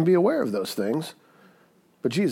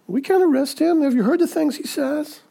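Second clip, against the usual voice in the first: much higher, amazed protest; awestruck guards; 12 semitones higher than usual. Retold or imitated. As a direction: play the guards defending their failure in wonder. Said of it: "They're like".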